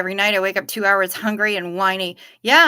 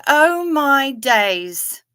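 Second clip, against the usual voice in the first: slow, higher British exclamation; a lightly voiced Kelly saying oh my days; imitating Kelly; 5 semitones higher, 6 dB louder, much slower than usual. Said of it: "as Kelly says".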